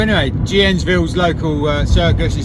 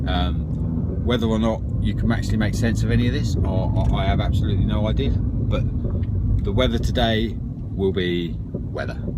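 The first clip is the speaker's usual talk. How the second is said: hapless weatherman; 7 semitones lower, 5 dB quieter than usual. Acted. Joey gives a clueless forecast to the studio, hesitant. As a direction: ramble uncertainly, trailing lower and softer.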